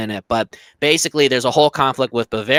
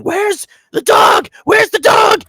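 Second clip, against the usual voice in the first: very high, loud, slow bellow of where's the dog; furious shout; 6 dB louder, slower than usual. Retold or imitated. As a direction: roar it like a frantic hero. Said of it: "Superman comes crashing into LexCorp and going".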